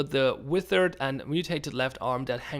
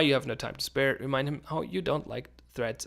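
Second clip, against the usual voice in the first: ordinary talk, nothing marked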